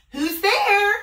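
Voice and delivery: loud high granny call; high old Granny. Acted. Granny calling out to the knock at the door.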